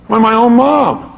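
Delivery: ordinary delivery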